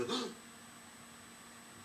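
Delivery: sharp high gasp of fear; a startled gasp; frightened gasp. Acted. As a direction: suck in air in terror. Jack caught by giant.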